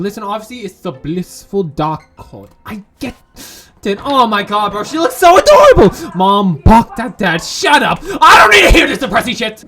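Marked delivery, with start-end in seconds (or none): 4.8-5.9 high loud gushing. Real, not acted. Player gushing over a cute character, excited. 7.4-9.6 loud fast snap; snapping. Acted. Character snapping at the arguing parents, angry.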